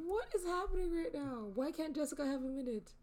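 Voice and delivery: very high, incredulous protest; mock protest. Real, not acted. Friend objects that Jessica deserves a minute, high mock protest.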